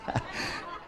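breathy laugh. Real, not acted. Brief laugh after joke.

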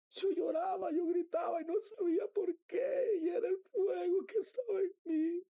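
high, crying testimony; overcome, crying through the words; tearful. Real, not acted. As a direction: wail it out, pitched far up. Testimony overcome while recalling weeping.

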